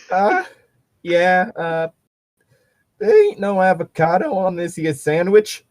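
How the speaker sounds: a nervous backwoods cook answering the boss. Pretend. Hick voice defending sandwich.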